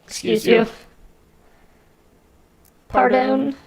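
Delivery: high, indignant entitled Karen. Pretend. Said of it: "Karen voice".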